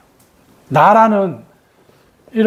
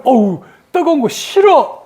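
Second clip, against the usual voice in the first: higher, drawn-out recoil from heat; recoiling refusal; 5 semitones higher than usual. Acted. Someone about to touch fire, recoiling refusal. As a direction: act out shrinking from something hot. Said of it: "이렇게 해야 된단 말이에요".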